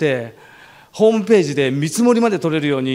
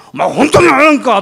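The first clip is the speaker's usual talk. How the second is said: imitating a gangster bellowing a threat, higher and louder; menacing yell; 7 semitones higher, 8 dB louder than usual. Union boss threatening the departing speaker, mock yakuza yell. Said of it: "ドスの効いた声で怒鳴られたんですよ".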